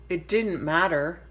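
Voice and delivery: plain reading voice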